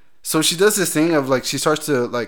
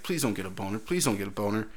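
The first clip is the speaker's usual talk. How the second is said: quiet inner pleading, much softer; pleading to himself; 4 semitones lower, 11 dB quieter than usual. Retold or imitated. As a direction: mutter it like a silent prayer. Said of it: "I was like".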